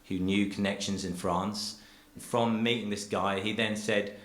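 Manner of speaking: usual speaking voice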